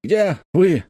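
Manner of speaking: high, strained radio call; tense voice. A narrator's reading. Engineer radioing the jeep for its position, tense. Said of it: "послышался напряжённый голос Арнольда".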